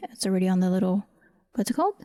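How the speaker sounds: ordinary delivery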